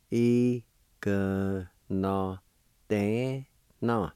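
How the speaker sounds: "Igno de na" slowly, in separate sounds; syllable by syllable. Shown as a demonstration. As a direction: leave a gap after each syllable. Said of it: "is pronounced like this".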